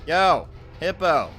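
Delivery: loud drawn-out call to the hippo; loud beckoning call. Real, not acted. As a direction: yell across the room to get its attention. Calling out to a game hippo.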